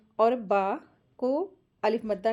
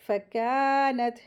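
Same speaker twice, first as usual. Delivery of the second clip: फ़कानत with a long held kaa; stretched madd; much slower than usual. Shown as a demonstration. Teacher models two-beat madd, फ़कानत with drawn-out kaa. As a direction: draw out the aa of फ़कानत. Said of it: "खींच के पढ़ेंगे".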